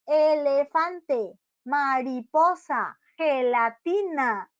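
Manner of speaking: Elefante, mariposa, gelatina very slowly split into syllables; elefante, mariposa, gelatina, sílaba por sílaba. Shown as a demonstration. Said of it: "cuatro sílabas".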